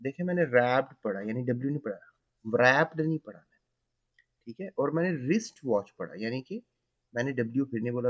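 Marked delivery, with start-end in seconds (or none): none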